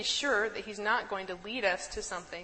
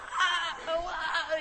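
high, loud mock yelps of panic, with a laugh; mock panicked yells; 9 semitones higher, 5 dB louder, much slower than usual. Sailors on the ship yelling in the storm, mock panicked yells.

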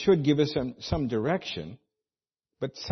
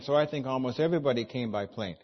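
ordinary talk

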